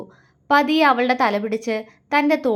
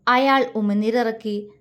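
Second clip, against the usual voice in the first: usual speaking voice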